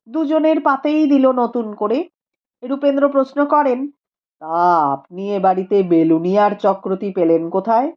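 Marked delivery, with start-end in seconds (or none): 4.4-7.9 lower, measured learned man; a calm scholar speaking gravely; Rupendra's voice. A narrator's reading. (নিচু গম্ভীর গলায় পণ্ডিত রূপেন্দ্র)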